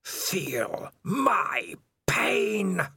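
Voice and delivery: very slow, high whispered hiss. Acted. Spider cursing Dangerous Beans in a slow whispered hiss. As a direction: draw it out like a curse.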